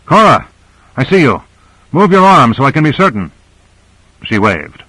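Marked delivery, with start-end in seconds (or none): 0.0-3.3 higher-pitched call to someone at a distance; raised, carrying voice. A narrator's reading. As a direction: call out urgently, voice lifted. Calling out to locate Cora.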